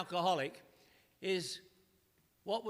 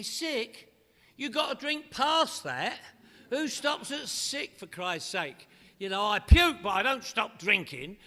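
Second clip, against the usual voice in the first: incredulous mock rant; 3 semitones higher, 8 dB louder, slower than usual. To sober relatives, retelling his loud incredulous rant. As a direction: scoff at anyone who stops at sick. Mocking friends who quit at sick.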